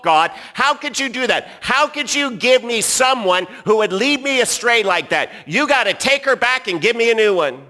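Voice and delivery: hammed-up Adam blaming God; Adam accusing God. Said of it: "notice what he says".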